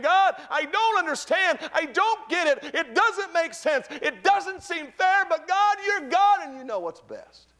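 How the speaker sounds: high, loud, drawn-out cry to God; anguished shouted prayer. Acted. Preacher voicing a believer's plea to God, anguished high shout. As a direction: cry out at full strength, far above normal pitch.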